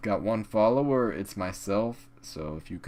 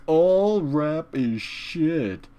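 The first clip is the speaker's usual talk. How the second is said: higher, very slow mock girlfriend voice; 8 semitones higher, 4 dB louder, much slower than usual. Imagined girlfriend dismisses all rap, mocked slow and high. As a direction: drag it out slowly in a sneering higher voice.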